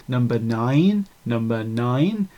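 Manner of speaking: put-on low, slow, drawn-out English accent; English accent, soft and stretched on "nine"; pronounced English accent. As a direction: imitate a very English announcer, stretching NINE upward. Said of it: "a very pronounced English accent says".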